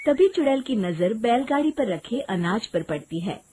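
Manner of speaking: ordinary delivery, nothing marked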